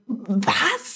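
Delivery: imitated appalled disbelief; a short mock-disgusted reaction. Shop worker reacting to the Radler question, mock disgusted. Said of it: "so richtig disgusted".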